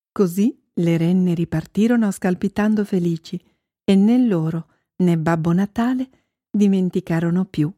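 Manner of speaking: plain reading voice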